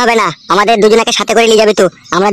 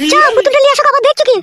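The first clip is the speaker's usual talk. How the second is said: much higher, shrill-pitched wife voice; scolding mother. Acted. Wife scolding husband over crying son, high-pitched.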